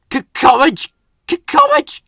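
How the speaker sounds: stuttering, rising loud engine chant; chuffing courage. Acted. Rheneas steaming up the shaking hill, loud stuttering chant. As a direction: puff out brave stammered words. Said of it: "he chuffed".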